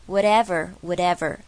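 WHATEVER loud and linked, as "would ever" in one word. Shown as a demonstration. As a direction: run "whatever" together smoothly and loudly. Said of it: "可以做连音". (whatever大声连音念)

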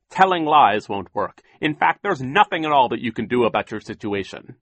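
firm, final verdict; Mister Poe voice. A narrator's reading. Poe sternly.

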